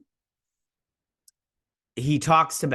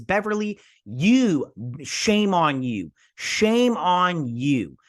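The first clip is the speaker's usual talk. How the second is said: slow, drawn-out, emphatic telling-off; indignant scolding; slower than usual. Acted. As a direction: shame them word by word, stretching each stressed one. Scolds Beverly directly for hiding child.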